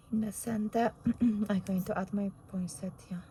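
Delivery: husky, hoarse voice; losing her voice, hoarse. Real, not acted. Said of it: "I think I'm losing my voice".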